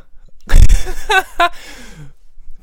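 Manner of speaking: high, loud cracking-up laugh. Real, not acted. Friend laughs loudly at his own mixed-up term, high cackle. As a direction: burst out laughing.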